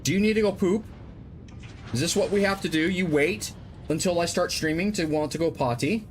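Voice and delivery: high-pitched, exasperated dog-owner voice; exasperated scolding. Real, not acted. Exasperated questions to the dogs.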